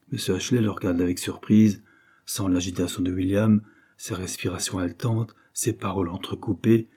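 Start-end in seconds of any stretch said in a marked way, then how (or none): none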